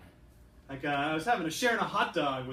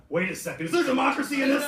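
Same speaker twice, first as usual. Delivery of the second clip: shocked discovery; 9 semitones higher, 8 dB louder, faster than usual. Acted. Diners discover democracy.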